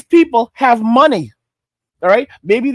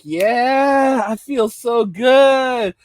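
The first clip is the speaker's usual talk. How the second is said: drawn-out, blissed-out mock girl; jazzed up; much slower than usual. Girl answering the streets after the candy, mock jazzed-up drawl. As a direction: stretch it out slowly, as if buzzing. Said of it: "all jazzed up".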